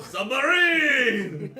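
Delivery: very long, high, loud shout; yelled. Acted. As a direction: yell it out, stretching the last syllable. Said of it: "and yelled".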